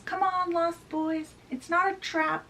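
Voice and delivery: high-pitched call at ordinary volume; mock yell. Peter summons the lost boys aboard, high mock yell. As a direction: call out high without real shouting. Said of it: "and he yells".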